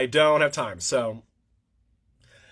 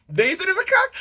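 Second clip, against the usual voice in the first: mocking jab; 13 semitones higher, 4 dB louder than usual. Real, not acted. Mocking aside.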